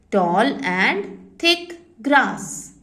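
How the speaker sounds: words stretched far apart again, calm; very slow dictation. Shown as a demonstration. Teacher repeating for the class, very slowly. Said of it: "repeating dictation".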